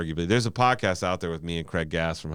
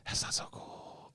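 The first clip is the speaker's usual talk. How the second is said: slow whispered reaction; very soft, drawn-out imitation of a listener; hushed second thoughts; 12 dB quieter, slower than usual. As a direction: whisper it slowly, as if let down. Imagined listeners changing their minds.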